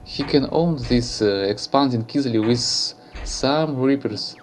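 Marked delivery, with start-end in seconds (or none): 0.0-2.0 louder, animated casting; play-by-play commentary. Real, not acted. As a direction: call the match live. Live match commentary, louder.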